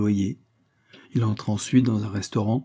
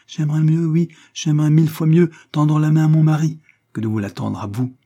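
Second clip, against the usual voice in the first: higher, louder, indignant outburst; impassioned refusal; 6 semitones higher, 7 dB louder than usual. A narrator's reading. Isabelle refuses Levison's money, impassioned. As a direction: refuse with mounting passion. Acted quarrel, proud refusal.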